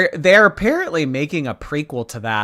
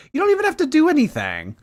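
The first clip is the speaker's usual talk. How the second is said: excited insistence; faster than usual. Real, not acted.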